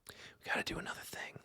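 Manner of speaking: very soft, quick whisper to oneself; whispered aside. Real, not acted. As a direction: repeat it under the breath, whispered and quick. Muttered repeat of line to self.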